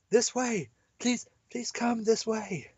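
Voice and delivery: a hint of a young girl calling. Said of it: "we can't do girl voices".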